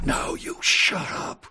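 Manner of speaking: slow whispered comeback. Acted. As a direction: whisper it back slowly. Acted argument.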